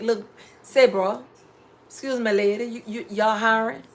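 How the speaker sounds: loud, sloppy street-style ask for a job; mock a careless applicant barging in; rude job ask. Imitating a young man barging into a shop, brash. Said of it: "talking like that".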